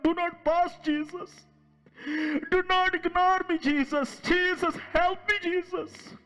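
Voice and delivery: tearful begging, slow and rising; pleading prayer. Shown as a demonstration. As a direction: cry out to Jesus for help. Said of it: "If you humble and say".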